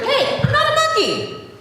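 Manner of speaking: high indignant pretend denial; playful high-pitched protest; mock protest. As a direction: act offended for the kids. Reader protests in mock outrage.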